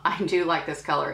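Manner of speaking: ordinary talk, nothing marked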